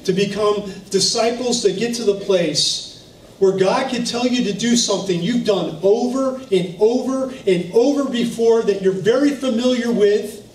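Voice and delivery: normal talking voice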